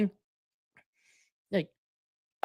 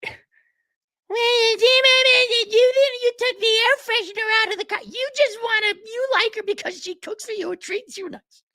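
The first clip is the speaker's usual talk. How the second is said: high squeaky mock whiny girlfriend, much higher; slower than usual. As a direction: mimic a nagging girlfriend in a high whine. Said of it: "mocking whiny girlfriend voice".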